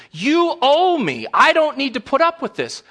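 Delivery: high, loud mock indignation; entitled protest. Child claims parent is in their debt, indignant. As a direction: demand it like an outraged child.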